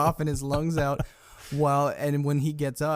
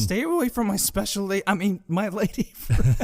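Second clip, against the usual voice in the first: high-pitched mock warning that stumbles; flustered self-correction; 8 semitones higher than usual. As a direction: warn him off high, then hastily correct yourself. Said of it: "and corrects himself right away".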